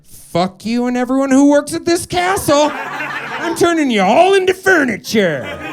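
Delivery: high, loud witch curse; furious witch. Retold or imitated. Witch cursing the boy's castle, high and loud. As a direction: spit the curse like a scorned hag. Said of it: "she's like".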